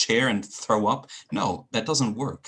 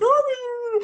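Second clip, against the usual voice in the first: put-on squealing high baby voice for a dog; excited dog voice; 6 dB louder than usual. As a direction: gush over a puppy. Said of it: "but I get super excited".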